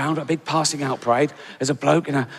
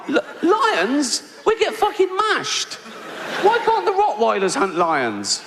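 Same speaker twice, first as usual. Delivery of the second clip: squeaky, much higher panic about lions; panicked high-pitched protest, laughing through it; panicked dog; 13 semitones higher, slower than usual. Acted. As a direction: yelp it like a terrified dog. Terrified Ridgeback protesting.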